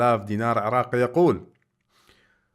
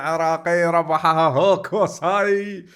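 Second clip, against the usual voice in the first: booming prize announcer; 5 semitones higher, 5 dB louder, much slower than usual. Acted. Quiz host announces prize won.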